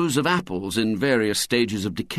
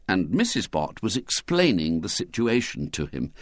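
plain reading voice

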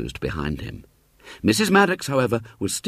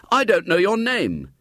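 put-on higher boyish call; calling after; 11 semitones higher than usual. William calling after the boy, higher. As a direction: call it down the road.

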